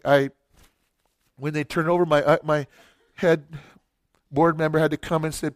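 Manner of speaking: ordinary talk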